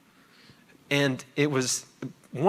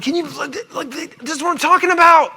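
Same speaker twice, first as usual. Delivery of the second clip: excited; 13 semitones higher, 6 dB louder than usual. Retold or imitated. Nudging wife, excited by the rant.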